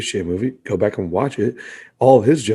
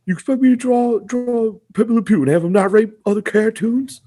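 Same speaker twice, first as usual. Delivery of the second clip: mock indignant, pitched much higher; incredulous animator; 10 semitones higher than usual. Animator balking at the writers, mock indignant high voice. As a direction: play the disbelieving animator, voice up high. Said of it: "just kinda being like".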